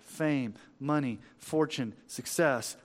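ordinary talk, nothing marked

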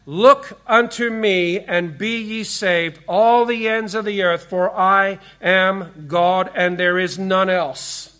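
slow, weighty verse with every word stressed; deliberate, punched-out Bible reading; each word emphasized. Retold or imitated. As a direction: imitate a preacher hammering out scripture word by word. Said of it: "emphasizing each word in turn".

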